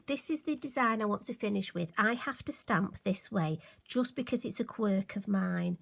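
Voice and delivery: usual speaking voice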